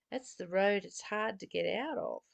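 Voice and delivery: ordinary talk, nothing marked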